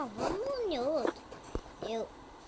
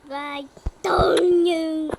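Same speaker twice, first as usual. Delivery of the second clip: loud drawn call; 4 semitones higher, 13 dB louder, much slower than usual. Real, not acted. Grito lento.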